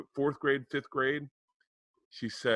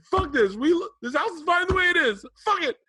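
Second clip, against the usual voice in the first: retold furious yell, much higher and louder; angry outburst; 7 dB louder than usual. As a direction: blow up in a shout, fed up with the renovation. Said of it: "I start to get angry".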